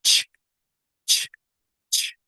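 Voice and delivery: "Ch, ch" as spaced voiceless bursts; CH sound. Shown as a demonstration. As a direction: release each "ch" with no vowel. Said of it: "y soltamos".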